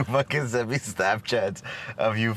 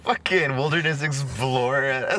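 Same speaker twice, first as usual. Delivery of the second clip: normal talking voice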